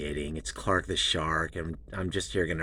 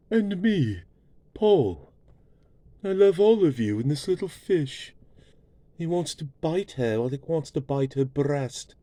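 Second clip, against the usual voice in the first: much higher and louder, a put-on squeaky voice; falsetto character voice; 10 semitones higher, 9 dB louder than usual. Paul butting in on the shark show, high squeaky voice. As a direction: pipe it up high and loud as Paul.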